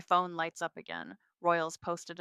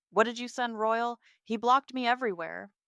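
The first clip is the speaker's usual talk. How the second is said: higher, louder angry demand; 5 semitones higher, 7 dB louder than usual. Acted. Sister storming in to confront her about the receipts, angry.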